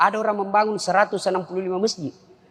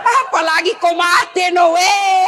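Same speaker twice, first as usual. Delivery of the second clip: louder, higher, laughing as he shouts it; 7 semitones higher, 5 dB louder, slower than usual. Retold or imitated. Husband teasing his wife, loud laughing shout. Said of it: "Saya bilang".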